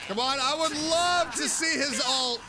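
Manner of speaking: ordinary delivery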